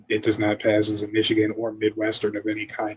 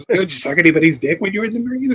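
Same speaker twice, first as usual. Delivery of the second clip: mocking movie quote; 9 semitones higher, 6 dB louder than usual. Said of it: "It's like".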